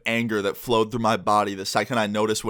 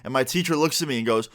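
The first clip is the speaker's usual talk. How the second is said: ordinary delivery, nothing marked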